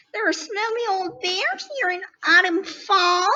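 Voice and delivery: mama bunny turning her nose up at bears; disgusted mama bunny. A narrator's reading. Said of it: "said Mama Bunny".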